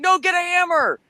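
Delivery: mock urgent. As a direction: insist in faked urgency. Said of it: "He's like".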